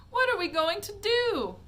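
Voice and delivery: high, loud despairing wail. A narrator's reading. Mother wailing over her shrunken son. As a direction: wail in dismay. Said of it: "wailed Missus T V".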